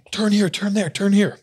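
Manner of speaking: high, urgent imitated directions; mock panic. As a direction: call out turns like a flustered passenger. Retold panicked directions during car chase.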